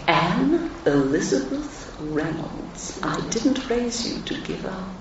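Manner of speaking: slow, hushed, measured motherly reproof; imitated mother. Mother correcting her impatient daughter, soft slow imitation. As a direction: say the full name slowly and softly, as mum. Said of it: "she would say".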